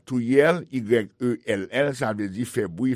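ordinary talk, nothing marked

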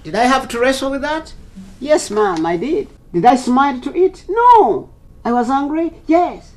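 high, forceful rhetorical questions and answers; heated self-questioning. Real, not acted. Bishop telling audience he wrestled with it, heated. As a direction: fire questions and answer them emphatically.

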